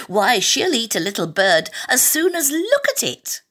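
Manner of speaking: put-on high, gushing child's enthusiasm; eager. A narrator's reading. Alice talking about her pet cat, eager. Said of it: "Alice replied eagerly".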